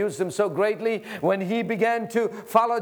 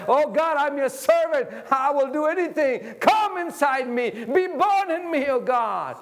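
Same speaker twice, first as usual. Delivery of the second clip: higher, pleading prayer; fervent prayer; 8 semitones higher than usual. Acted. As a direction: pray it out with longing.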